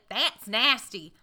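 slow, drawn-out Greensboro North Carolina "That's nasty"; Greensboro accent. Retold or imitated. Mocking the counter girl, lightly voiced Greensboro accent. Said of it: "That's the accent of Greensboro".

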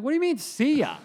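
high, incredulous "what do you mean"; mock disbelief. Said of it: "And I'm like".

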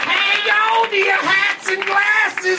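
high loud theme park announcer; ride announcer. Acted. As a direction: shout it like a ride barker. Ride announcer bit on recording.

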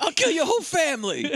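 high, hammed-up threatening yell; screamed threat. Imitating a film character threatening her friend, screamed. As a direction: shriek the threat in mock fury.

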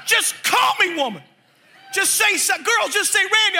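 high-pitched mock plea to mom; urgent pleading. Son urges mom to call him in, high mock pleading. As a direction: beg in a high, comic voice.

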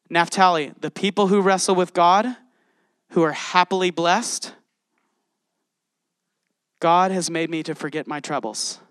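usual speaking voice

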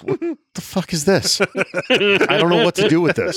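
high, slow, baffled voice; confused player voice. Retold or imitated. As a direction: play a bewildered quarterback. Players confused by new defense.

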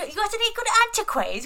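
high, loud Cockney hustler voice; a thick Cockney girl fishing for loot; Cockney thief sizing up the house; Cockney accent. Retold or imitated. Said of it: "really thick Cockney accents".

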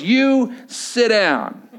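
drawn-out officer's command, imitated; stern order. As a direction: act out a very slow, firm order. Officer orders angry teen to sit.

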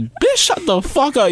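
a hammed-up retort to the nagging friend; mock snap. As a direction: snap it out high and slow. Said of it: "You be like".

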